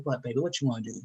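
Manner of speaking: lightly voiced gentle father; man voice. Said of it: "that's my man voice".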